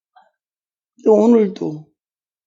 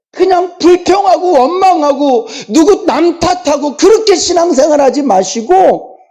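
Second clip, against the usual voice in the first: fervent preaching; 9 semitones higher than usual. Real, not acted. Pastor urging the congregation to really pray, fervent raised preaching. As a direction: urge the flock, voice lifted and straining.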